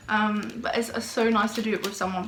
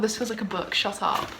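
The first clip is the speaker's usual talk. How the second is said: ordinary talk, nothing marked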